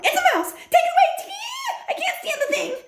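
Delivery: high shrieking panic; yelling in fright. A narrator's reading. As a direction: shriek it, terrified of the mouse.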